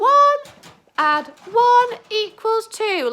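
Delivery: very high and loud. Pretend. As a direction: squeal it out high. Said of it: "It's quite high".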